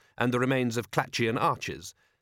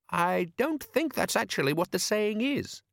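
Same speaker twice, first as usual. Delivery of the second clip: put-on much higher eager boy's voice; young boy voice; 9 semitones higher than usual. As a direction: pitch up like an eager lad. Son character speaking.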